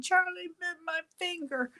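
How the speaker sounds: high British child voice imitating the viral video; British child accent. Retelling the viral video boy to the viewers, British accent. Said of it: "in a British accent".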